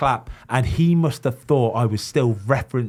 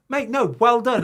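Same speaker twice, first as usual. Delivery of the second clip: protesting innocence; 12 semitones higher than usual. Retold or imitated.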